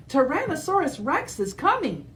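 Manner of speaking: high, hurried alarm; alarmed warning. Acted. Triceratops warning friends, high alarmed voice. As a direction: sound the alarm, breathless.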